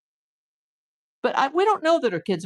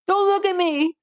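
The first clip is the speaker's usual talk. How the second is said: mocking whine; 8 semitones higher than usual. Vlogger mocking the hiding kids, in a whiny voice. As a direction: mimic someone cowering from cameras. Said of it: "they're hiding under hoodies going".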